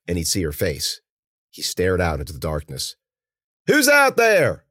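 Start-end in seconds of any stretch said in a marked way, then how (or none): 3.7-4.6 high, loud shouted challenge; calling out. Acted. Mueller challenging an unseen listener, calling out. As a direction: shout it out into the darkness.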